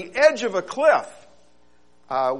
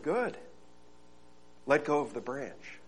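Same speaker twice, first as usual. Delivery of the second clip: soft, lower calm instruction; calm command; 4 semitones lower, 12 dB quieter than usual. Acted. God calmly telling the man to let go. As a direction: tell him plainly and gently. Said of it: "the voice returned".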